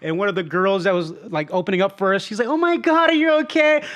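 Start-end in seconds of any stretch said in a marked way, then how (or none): 2.5-3.8 high-pitched mock concern; exaggerated high worried voice; imitating an alarmed girl. Alarmed girl at restaurant, retold.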